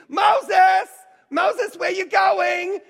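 shrill shouted Yiddish wife calling; Yiddish accent. Acted. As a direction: yell after him in a high Yiddish accent. Wife calls after Moses.

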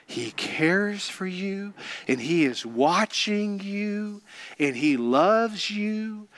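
slow, hushed comfort; tender. Real, not acted. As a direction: gently reassure the hurting listener. Gentle reassurance after whisper.

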